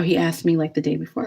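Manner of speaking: normal talking voice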